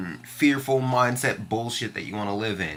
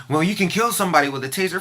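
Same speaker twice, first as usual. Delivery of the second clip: mocking imitation; 6 semitones higher, 3 dB louder, faster than usual. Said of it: "She's like".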